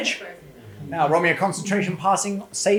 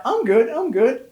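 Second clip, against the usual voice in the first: mock reassurance; 9 semitones higher, 6 dB louder than usual. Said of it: "he's like".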